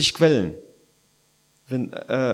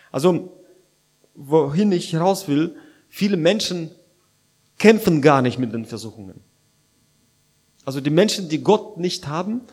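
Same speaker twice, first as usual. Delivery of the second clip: normal talking voice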